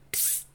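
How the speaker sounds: short, soft psst; hushing hiss. A narrator's reading. Homunculus silencing the dwarf with a soft hiss.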